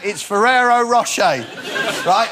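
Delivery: ordinary talk, nothing marked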